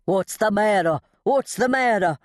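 high, urgent demand; alarmed, rising in pitch on the repeat; yelling in alarm. A narrator's reading. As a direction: yell it right into someone's ear. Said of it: "yelled in my ear".

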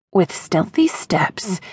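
plain reading voice

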